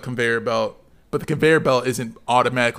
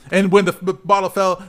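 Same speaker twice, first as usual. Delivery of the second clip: usual speaking voice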